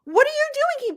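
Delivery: high, loud furious yell over the puck; angry yell. Retold or imitated. Retelling yelling at a man who took her puck, high and loud. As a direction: yell it like someone stole your prize.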